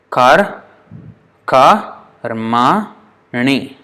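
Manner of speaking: "Kar, ka, rma, ni" broken up with pauses; syllable by syllable. Shown as a demonstration. Teacher counting syllables for students, one by one. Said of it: "how many syllables are there?".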